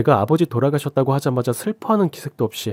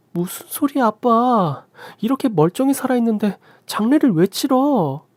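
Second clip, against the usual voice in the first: much higher, louder astonished cry; shocked disbelief; 12 semitones higher, 4 dB louder than usual. Acted. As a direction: react with stunned disbelief. Daughter shocked at request.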